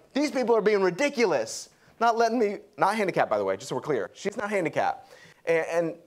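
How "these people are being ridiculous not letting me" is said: huffy woman. Retold or imitated. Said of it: "She was like".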